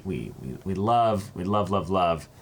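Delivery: ordinary talk, nothing marked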